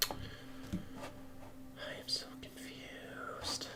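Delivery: slow, breathy whisper of "so confused", pushing the word "so"; whispered confusion. Real, not acted. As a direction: whisper it to yourself, stretching out "so".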